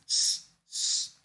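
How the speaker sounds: voiceless "X" said as a soft sh hiss; quiet sh hiss; SH sound. Shown as a demonstration. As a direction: hush "X, X" as sh. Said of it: "Aí o X".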